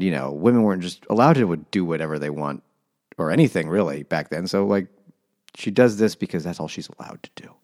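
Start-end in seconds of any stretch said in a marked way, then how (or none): 6.1-7.5 whispered, voiceless emphasis. Real, not acted. Hammering home the housewife's lot, in a whisper. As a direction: drop to a conspiratorial whisper.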